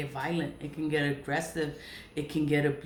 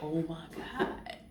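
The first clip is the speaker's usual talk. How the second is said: hushed disbelief; 5 dB quieter, slower than usual. Retold or imitated. Reacting to the stories again, slow hushed Oh my God. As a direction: drop it to a stunned hush.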